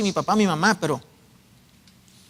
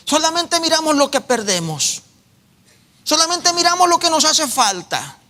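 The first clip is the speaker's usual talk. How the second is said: fervent preaching; 4 semitones higher than usual. Acted. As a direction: rise up and press the point. Preaching at full force. (predicación fervorosa, aguda y fuerte)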